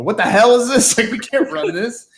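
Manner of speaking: a hammed-up angry editor storming in. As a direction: boss barging in, half-joking outrage. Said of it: "come storming in and go".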